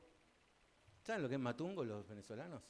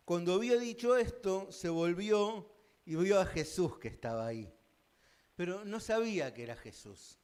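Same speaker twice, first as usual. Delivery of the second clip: louder, higher solemn Bible reading; scripture reading; 3 semitones higher, 6 dB louder than usual. Acted. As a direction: proclaim the gospel passage to the church. Lee el evangelio en voz alta. (lectura solemne del evangelio)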